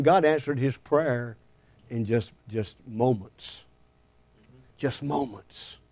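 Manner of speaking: dropping to a near hush, slow; slow hushed preacher's point; hushed emphasis. Real, not acted. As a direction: pull them in softly. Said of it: "preacher drops voice for effect".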